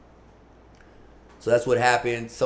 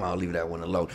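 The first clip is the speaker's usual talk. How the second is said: usual speaking voice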